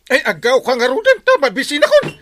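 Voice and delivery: loud, high yelling through laughter; laughing yell. Acted. Laughing, shouting in pain. (agkatkatawa a napigsa nga ikkis)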